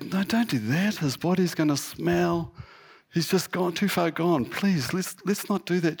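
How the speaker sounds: high-pitched pleading, imitating the sisters; acted anxious protest. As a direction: voice the sisters' objection up high, urging him to stop. Sisters object to opening the tomb.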